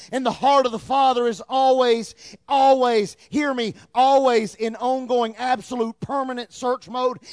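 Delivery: rising, emphatic sermon voice; preaching. Acted. Pastor urges the church with rising emphasis. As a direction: build it up like a preacher.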